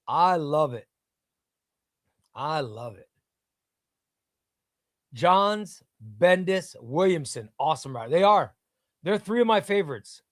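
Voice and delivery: ordinary talk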